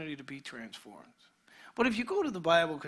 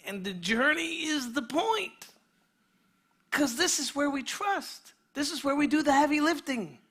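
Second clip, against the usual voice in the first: preaching at full force; 7 semitones higher, 6 dB louder than usual. Real, not acted. Pastor rousing the congregation about trusting in storms, impassioned. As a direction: build it like a sermon climax.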